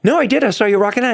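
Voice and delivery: excitedly insisting he saw him; insistent. Retold or imitated. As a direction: push back eagerly. Insisting in retold dialogue.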